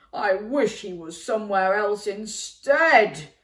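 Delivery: big, loud giant voice chanting; booming giant. A narrator's reading. Giant roaring his chant at Jack's scent. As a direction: boom it out like a giant.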